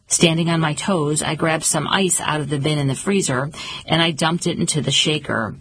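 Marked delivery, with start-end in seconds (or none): none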